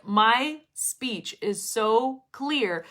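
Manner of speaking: very slow, word-by-word emphatic delivery; showing off clarity. Shown as a demonstration. Teacher showing students a trademark clarity, very slow and distinct. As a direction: spell out each word with deliberate, crystal clarity. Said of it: "my trademark, I would say, is clarity".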